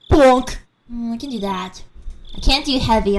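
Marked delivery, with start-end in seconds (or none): 0.1-0.6 game character impression. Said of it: "T F two voices".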